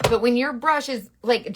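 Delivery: normal talking voice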